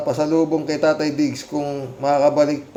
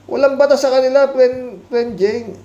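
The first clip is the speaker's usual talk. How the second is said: indignant outburst; 10 semitones higher, 4 dB louder than usual. Real, not acted. Indignant rant to commenter.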